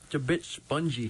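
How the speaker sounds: chewing while talking; mouth full. Real, not acted. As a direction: speak through a bite. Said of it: "swallowing the meat".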